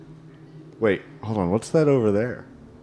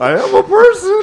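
high silly voice; 9 dB louder than usual. Acted. NPC voice.

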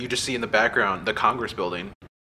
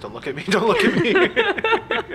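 laughing plea; 5 dB louder, slower than usual. Retold or imitated. Pleading with trooper, laughing.

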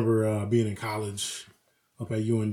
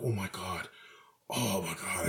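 softer, lower, fretful mock panic; a hushed, childish mock panic; 6 semitones lower, 12 dB quieter, slower than usual. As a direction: imitate a grown man whimpering in panic. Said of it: "panicking like a child".

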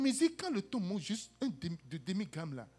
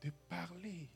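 slow, soft pleading; humble plea; 8 dB quieter, slower than usual. Acted. Abigail throwing herself at David's feet, slow soft humble plea. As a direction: plead on your knees, slowly. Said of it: "elle se jeta".

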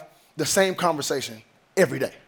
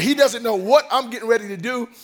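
normal talking voice

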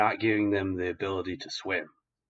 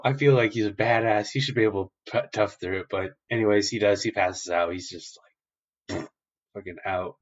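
usual speaking voice

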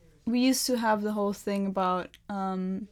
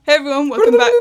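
loud; 4 semitones higher, 13 dB louder, faster than usual. Real, not acted. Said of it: "I've just been assaulted in the studio".

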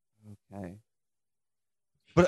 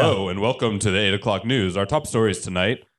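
lower, booming anchor read; deep news anchor voice; 7 semitones lower than usual. Retold or imitated. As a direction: announce it like the eight o'clock news. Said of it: "have extremely deep voices".

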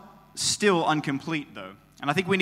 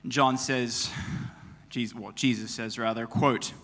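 normal talking voice

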